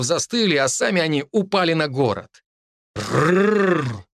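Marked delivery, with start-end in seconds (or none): 3.0-4.1 rolled growling rrr; fox growl. A narrator's reading. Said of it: "прорычал огромный девятихвостый белый лис".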